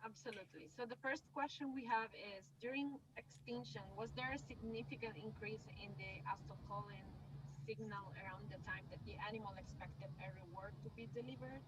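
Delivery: faint voice far from the mic; barely audible. Real, not acted. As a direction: speak almost inaudibly, off mic. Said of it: "your voice is very low".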